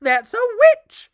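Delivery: high, loud shocked squeal. Acted. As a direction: shriek it in alarm. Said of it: "a queen squealed".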